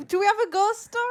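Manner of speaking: high, loud eager question; excited. Real, not acted. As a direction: ask it bouncing with excitement. Eager for ghost story.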